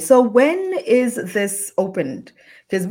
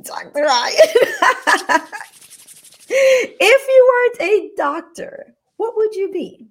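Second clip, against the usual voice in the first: laughing; 12 semitones higher, 7 dB louder than usual. Real, not acted. Host asks fun question, laughing.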